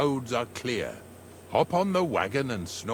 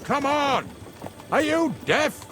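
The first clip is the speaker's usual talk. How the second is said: exasperated shout; 13 semitones higher than usual. Acted. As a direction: yell at the stubborn horses. Shouting at stubborn horses.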